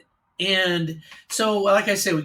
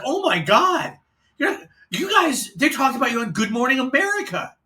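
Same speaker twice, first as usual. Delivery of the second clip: breathless, much higher excited blurt; excited gasp; 7 semitones higher, 3 dB louder than usual. Retold or imitated. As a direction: burst in with huge news. Coworker bursts in excited.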